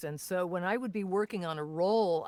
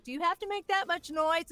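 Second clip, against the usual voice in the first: higher-pitched imitation of a put-upon parent; lightly voiced exasperated parent; 8 semitones higher than usual. Actor recalling parents putting up with the recordings, mild exasperation. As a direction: lightly mimic a parent complaining. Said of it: "My poor parents had to deal with me".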